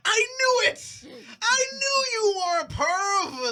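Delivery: high, loud, slow outraged yell; mock accusing yell. Daughter catching her stepdad, mock outraged yell. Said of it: "It's like".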